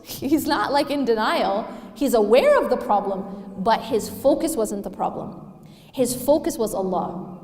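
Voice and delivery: ordinary delivery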